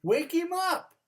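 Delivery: high, loud squirrel call. Acted. Squirrel urging the rabbit, high and loud. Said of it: "said the squirrel".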